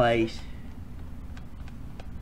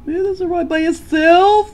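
squeaky high voice; 9 dB louder than usual. Acted. High character voice.

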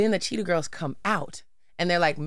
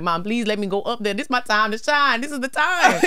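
pleading; 5 semitones higher than usual. Retold or imitated. Begging mom to go on stage.

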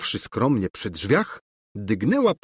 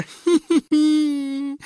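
very high squealed "hee hee"; screechy, gloating giggle; villainous cackle; 4 dB louder, much slower than usual. A narrator's reading. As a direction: cackle piercingly at the victim. Governess cackles while threatening.

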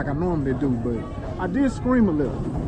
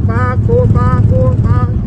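loud countdown; 6 semitones higher, 8 dB louder, much slower than usual. Real, not acted. Countdown before the ride.

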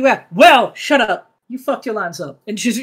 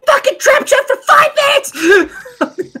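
muffled furious shout. Acted. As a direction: scream it at the partner inside. Shout heard through door.

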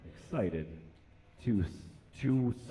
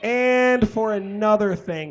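loud; 6 semitones higher, 6 dB louder than usual. Acted. Comic reading builds to yell.

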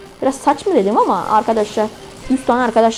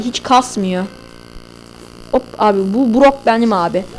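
ordinary talk

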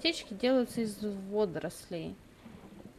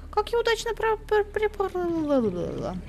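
very high, louder flustered squeal; excited squeal; 11 semitones higher, 4 dB louder, slower than usual. Real, not acted. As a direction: squeak it out in a panic. (взволнованный писк)

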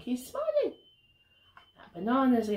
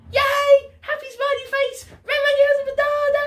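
high, quick, gleeful storytelling; excited cheering; 12 semitones higher, 7 dB louder, faster than usual. Pretend. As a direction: celebrate with a little squeal. Celebrating red monkey's banana.

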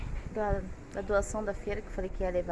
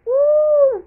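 high, loud squeal of delight; excited whoop; 17 dB louder than usual. Real, not acted. Vlogger squealing over her Easter chocolate, excited whoop.

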